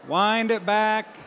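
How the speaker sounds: words dragged out very slowly; slowed-down rewind voice; slow motion. Pretend. Pastor slowing the moment down.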